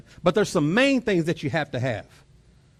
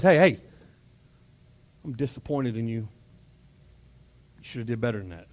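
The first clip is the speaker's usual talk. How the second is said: stern voice; 7 semitones lower than usual. Retold or imitated. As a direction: scold like a deeply disappointed dad. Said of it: "I could go with a stern voice".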